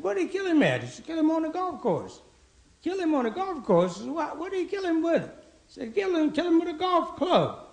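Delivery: high-pitched, incredulous back-and-forth; worked-up golf banter. Acted. Golfer and friend trade shocked news.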